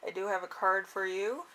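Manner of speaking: usual speaking voice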